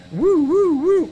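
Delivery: high, loud excited whoops; excited whooping. Real, not acted.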